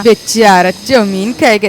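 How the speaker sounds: mock the poor boy's wish; mocking sneer. Aunt mocks the boy. (ताना मारती आवाज़)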